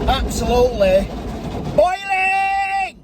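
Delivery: high loud drawn-out boiling; exaggerated exclamation. Shown as a demonstration. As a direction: shout it out hammy. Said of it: "As I was saying".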